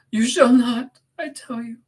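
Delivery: high, forceful protest; desperate Catherine, insistent. Acted. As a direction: forbid it with rising desperation. Catherine refuses to let him go.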